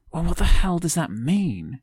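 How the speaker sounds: high, loud baffled outburst; confused. A narrator's reading. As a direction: blurt it out in disbelief. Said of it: "a confused request".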